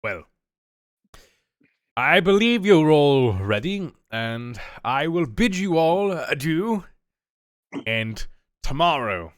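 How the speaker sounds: dwarf farewell. Acted.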